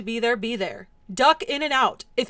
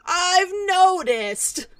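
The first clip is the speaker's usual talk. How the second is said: slow, quieter I've noticed; drawn-out sarcasm again softer; 7 semitones higher, 4 dB louder, much slower than usual. Acted. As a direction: drag it out, deflated.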